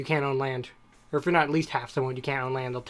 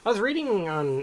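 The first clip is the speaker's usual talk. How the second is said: ordinary talk, nothing marked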